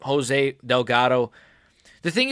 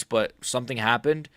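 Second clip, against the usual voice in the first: usual speaking voice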